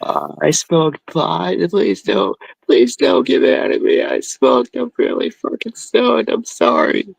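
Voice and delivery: put-on high, pleading little-boy whine; whiny pleading. Scared stoned son begs his mom not to get mad. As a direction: beg her not to be mad, near tears.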